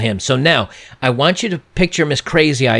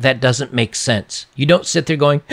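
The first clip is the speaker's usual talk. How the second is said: usual speaking voice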